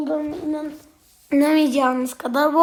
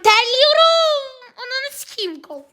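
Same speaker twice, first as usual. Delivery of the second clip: hammed-up crying whine; mock crying; 9 semitones higher than usual. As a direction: whine it like a fake sob. Mock crying under pressure.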